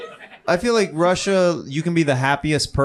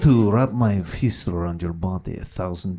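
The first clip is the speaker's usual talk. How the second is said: menacing Russian man; 8 semitones lower, slower than usual. Retold or imitated. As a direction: growl it slowly like a scary Russian man. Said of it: "you hear a guy do it".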